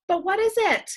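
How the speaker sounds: urgent worried plea; anxious question. Acted. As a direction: ask it with rising alarm. Worried teacher asking.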